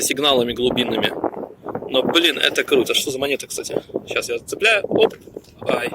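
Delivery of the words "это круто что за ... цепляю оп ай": ordinary talk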